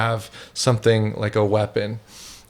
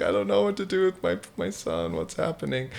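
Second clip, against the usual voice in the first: high, fast, fading worried mother; a panicked mother who can't cope; 9 semitones higher, 7 dB quieter, faster than usual. Pretend. Mother begging for help, a high rushed fading plea. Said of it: "a mother who's like".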